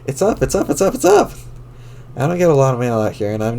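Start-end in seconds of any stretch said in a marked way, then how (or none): 0.1-0.7 excited. Acted. Mailbox flag goes up. 0.7-1.3 shrieked much higher and louder; squealing. Acted. Mailbox flag goes up.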